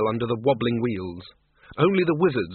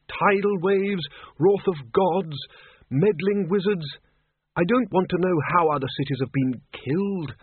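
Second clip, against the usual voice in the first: higher-pitched character voice, listing item by item; Windle Poons, frustrated; 5 semitones higher than usual. Acted. Windle Poons searches books on ended cities, frustrated. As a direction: run through the list with exasperation.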